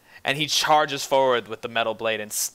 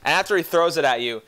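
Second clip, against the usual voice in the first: normal talking voice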